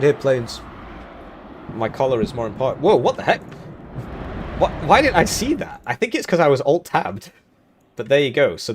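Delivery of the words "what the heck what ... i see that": ordinary delivery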